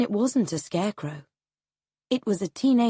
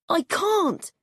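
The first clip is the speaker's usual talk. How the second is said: put-on much higher, small scared boy voice; frightened boy; 10 semitones higher than usual. A narrator's reading. Boy refusing his father in helicopter, frightened. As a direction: plead like a terrified child.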